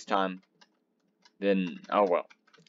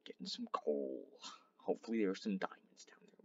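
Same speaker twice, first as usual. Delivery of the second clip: hushed commentary while digging; whisper; 2 semitones lower, 14 dB quieter than usual. Real, not acted. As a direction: whisper as if not to disturb.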